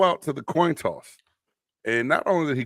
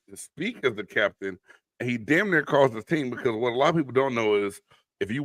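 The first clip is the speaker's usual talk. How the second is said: usual speaking voice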